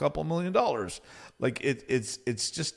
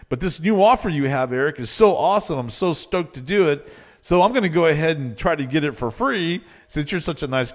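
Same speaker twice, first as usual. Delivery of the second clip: loud, higher-pitched gushing client; mock enthusiasm; 5 semitones higher, 7 dB louder than usual. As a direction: imitate an over-eager freeloader buttering up the coach. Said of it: "here's the translation".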